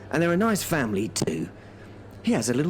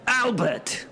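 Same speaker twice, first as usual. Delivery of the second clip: high exasperated call of the name; sharp call; 9 semitones higher than usual. Acted. Host calling Albert back to the story. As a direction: snap his name to get him back on track.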